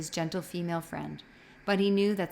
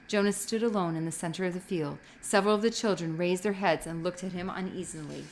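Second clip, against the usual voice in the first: ordinary delivery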